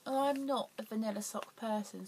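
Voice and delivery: ordinary delivery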